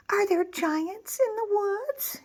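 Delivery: high, slow worried little wolf voice. Acted. Little wolf asks his mother, worried and high. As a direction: ask it like a frightened pup. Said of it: "he asked his mother".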